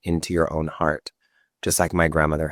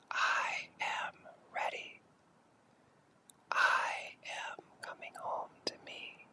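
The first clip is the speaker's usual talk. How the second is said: much softer, slow whispered affirmations; 19 dB quieter, slower than usual. Shown as a demonstration. Guide keeps whispering affirmations with the group. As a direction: breathe each phrase slowly as a whisper. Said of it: "A few more times with a whisper".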